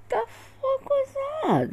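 high, slow, crying disbelief; baffled cry. Retold or imitated. Alone after police leave, a high slow stunned cry. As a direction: wail it out in stunned confusion.

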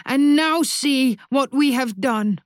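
high, slow anguished cry; a mother's raw howl of grief. A narrator's reading. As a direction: wail it out in despair. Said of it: "Mamma howls".

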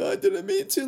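very high whiny mock sob voice; mock crying predator. Mocking the crying predator's excuse to cops. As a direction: whine it like a caught crybaby. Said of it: "they're like".

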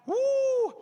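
high shouted woo; loud whoop. Real, not acted. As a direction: let out a joyful whoop. Preacher whoops to congregation.

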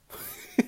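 breathy run of chuckles; real laugh. Real, not acted. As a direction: crack up at yourself. Laughs at sounding professional.

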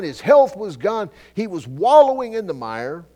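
loud, high preaching, slowing on key lines; impassioned preaching. Acted. Preacher telling the congregation Job's story, impassioned. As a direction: drive home Job's suffering.

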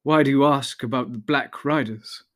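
ordinary delivery, nothing marked

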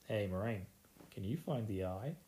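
understated jokey innuendo; mock suggestive. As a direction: slip a dirty hint into an innocent question. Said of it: "it just looked really dirty to me".

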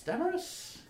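very high gnome voice with rising end; gnome repeating a name. Acted. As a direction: echo a new name in a high pitch. Gnome repeats each name on meeting.

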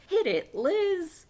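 high, loud and drawn out, a showy cue; gleeful teacher's cue. Acted. Miss Frizzle cueing her pet lizard, loud and drawn out. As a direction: call it out slowly like a teacher starting a magic trick. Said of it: "turns to her pet lizard Liz and says".

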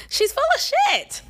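squeaky high call-out of a phony; incredulous exclaim. Real, not acted. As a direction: throw it up high in outraged disbelief. Exasperated call-out of celebrity.

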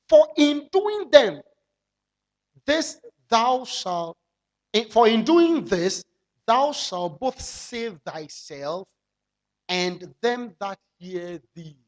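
slow, weighty scripture recitation; solemn scripture reading. Acted. Preacher reciting the verse to the church, slow and solemn. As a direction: lay each word down slowly.

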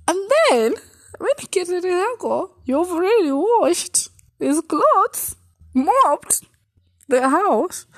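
high, loud, slowed-down wail; anguished high lament. Retold or imitated. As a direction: cry it out at the top of your voice. Emotional climax of retold story.

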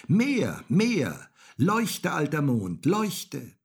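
high, loud, insistent commands; shouting. A narrator's reading. Häwelmann orders the old moon to shine, shouting. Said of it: "schrie Häwelmann".

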